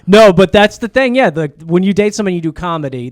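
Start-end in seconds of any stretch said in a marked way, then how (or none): none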